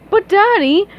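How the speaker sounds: high pleading daughter voice; young princess begging. Acted.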